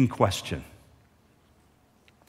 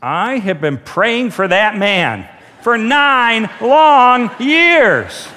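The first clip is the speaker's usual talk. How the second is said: loud, drawn-out retold outburst; slow, raised voice hammering out the long wait; emphatic declaration; 3 semitones higher, 5 dB louder, much slower than usual. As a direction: build to a ringing, stretched-out finish. Acts out woman's emotional declaration.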